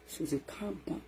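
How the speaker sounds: ordinary delivery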